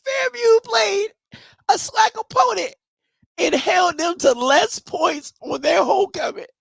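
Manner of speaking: high squealing laugh-talk; wheezing with laughter, can barely speak; helpless laughter. Real, not acted. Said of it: "I'm sorry. I don't know why this is so funny".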